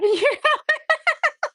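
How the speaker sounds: real delighted laugh; a high real giggle; high burst of laughter. Real, not acted. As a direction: squeal of laughter at the punchline. Neighbor story punchline laugh.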